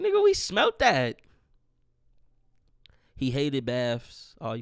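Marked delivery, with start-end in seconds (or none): 0.0-1.2 much higher, louder joking call-out; mock call-out. Talking back to the farting dog, a loud joking call-out. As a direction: bust the dog for the smell, laughing at it.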